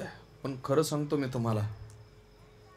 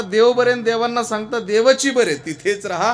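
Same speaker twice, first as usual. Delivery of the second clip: raised, higher preaching voice at full push; loud exhortation; 8 semitones higher, 11 dB louder than usual. Retold or imitated. Preacher tells gods to stay where they are, loud exhortation. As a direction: proclaim it loudly, pitched up.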